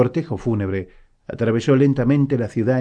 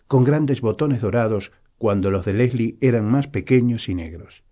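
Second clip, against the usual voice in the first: ordinary delivery, nothing marked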